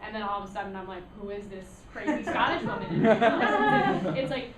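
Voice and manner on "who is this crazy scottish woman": normal talking voice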